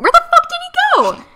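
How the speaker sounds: very high loud outraged yell; shocked shout. Acted. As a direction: shriek it in disbelief. Outraged reaction to boy vanishing.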